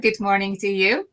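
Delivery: usual speaking voice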